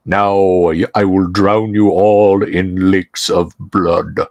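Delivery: low, slow, booming villain proclaiming doom; tolling bell voice. Acted. Villain's voice booming down the hallway at the heroes, low and slow. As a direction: toll each word like a bell from far up the hallway. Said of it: "you hear a voice roll out like a tolling bell".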